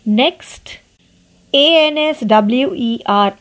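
ordinary talk

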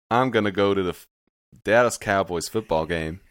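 put-on Texas-style American accent. Shown as a demonstration. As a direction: do an American from Dallas. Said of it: "your voice in an American accent".

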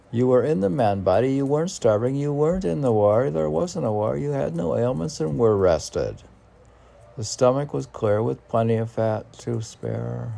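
brisk, animated recital of the verse; lively poem reading. Acted. Rereads the poem faster.

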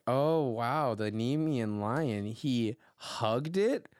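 slow, low feigned amazement; feigned wonder. Acted. Listener humouring Fertone's familiar story, feigned wonder. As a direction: draw it out as if impressed. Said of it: "and I'm like".

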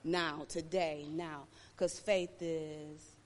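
low, soft, slow sermon; hushed preaching. Acted. Preacher speaking low and soft to his listeners. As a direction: pull them in close.

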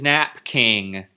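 "Nap King" drawn out as two separate words; typo read literally. Shown as a demonstration. Reading the book's typo aloud, "Nap King" as two words. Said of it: "There's a typo that says Nap King".

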